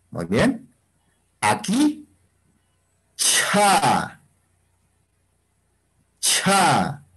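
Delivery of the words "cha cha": "Cha" with a long puff of air after the ch; aspirated ch. Shown as a demonstration. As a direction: model "Cha" with a breathy release of the ch. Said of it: "voy a leer yo y cada uno de ustedes va a pronunciar".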